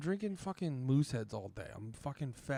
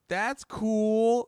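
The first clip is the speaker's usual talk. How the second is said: mock flat dismissal; 8 semitones higher, 9 dB louder than usual. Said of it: "And she just goes".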